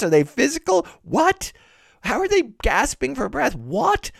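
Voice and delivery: high-pitched, escalating bafflement; exasperated disbelief. Acted. Reader demanding sense of torn body parts, wildly incredulous. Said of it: "three what moments in which I, as a reader, go, What".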